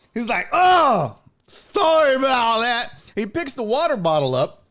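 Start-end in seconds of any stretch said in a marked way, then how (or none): 0.5-2.9 higher, loud, slow sloppy sorry; drunk apology. Retold or imitated. Drunk guest apologizes for spilling water, loud and high. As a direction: apologize like a drunk who doesn't care.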